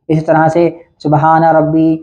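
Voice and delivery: normal talking voice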